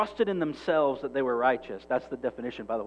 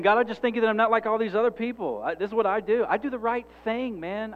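higher, sassy self-righteous whine, rushing at the end; 8 semitones higher, 7 dB louder than usual. Retold or imitated. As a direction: sneer it out like a smug know-it-all. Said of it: "That was with a little sassiness".

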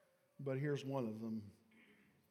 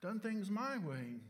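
smug reminder; 6 semitones higher than usual. Retold or imitated. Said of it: "I reminded her".

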